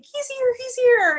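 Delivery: high frantic gasp of alarm; panicked. Retold or imitated. Dubenich panicking as Nate shows up, high and frantic. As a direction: squeak it out like the boss just walked in.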